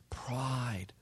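a hushed, breathless whisper of one word; whispered verdict. Acted. Preacher to congregation naming the sin, in a whisper. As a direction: let the word drop like a confession.

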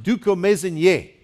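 dukomezenye with the final "ye" punched; stress on last syllable. Shown as a demonstration. Preacher introducing a Kinyarwanda word, stressing its last syllable. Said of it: "You put the emphasis on the f-, last syllable".

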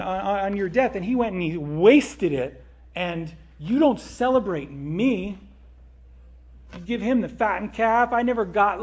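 loud, whiny, hurt rant to dad; aggrieved complaint. Acted. Older son complaining to his father, loud aggrieved whine.

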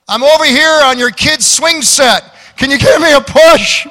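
put-on the drunk hollering from the swing set. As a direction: drunk calling from the kid's swing set for a push. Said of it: "And the voice said".

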